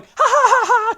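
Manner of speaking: high, loud mock maniac laugh; hammed-up mad "ha ha"; psychotic laugh. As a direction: imitate a crazed villain's cackle. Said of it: "He's laughing psychotically".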